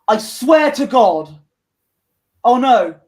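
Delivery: loud, exasperated dismay; real exasperation. Real, not acted. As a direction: protest loudly at the bad news. Dismay at an unwanted substitution.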